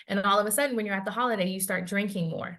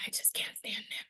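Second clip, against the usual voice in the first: whispered aside; 13 dB quieter than usual. Retold or imitated. Voicing a private thought.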